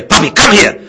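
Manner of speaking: loud, high, urgent recall shout; shouted command. Shown as a demonstration. Trainer demonstrating shouted recall in puppy class, loud high shout. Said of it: "when I would shout at her".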